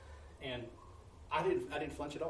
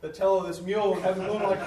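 higher and louder, laughing as he says it; 4 semitones higher, 9 dB louder than usual. Real, not acted. Preacher recalling the mule ride, laughing. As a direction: laugh out the picture of grabbing the tail.

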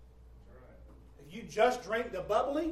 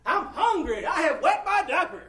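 mock crying baby; 6 semitones higher, 11 dB louder than usual. Baby crying out for help and a diaper, hammed-up wail. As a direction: cry out needs like an acted hungry baby. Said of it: "inside Junior is to cry".

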